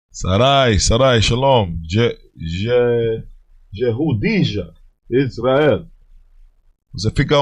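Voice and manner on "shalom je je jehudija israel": usual speaking voice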